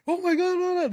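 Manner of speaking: high-pitched mock yell of commentators; imitated excited yelling. Retelling what came through the headphones, imitated excited yelling. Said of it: "they're yelling".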